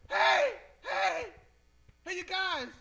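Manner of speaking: high-pitched mimicked "hey" hollered after someone; imitated calling out. Lady calling after two guys half a block away, high imitated call.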